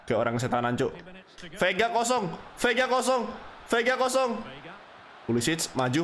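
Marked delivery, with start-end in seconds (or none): 1.6-4.3 urgent calling out. Real, not acted. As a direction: call out the open man, more urgent each time. Calling out an open player.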